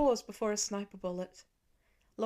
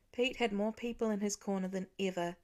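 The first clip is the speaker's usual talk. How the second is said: ordinary delivery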